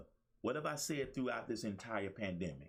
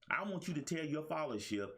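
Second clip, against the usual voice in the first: usual speaking voice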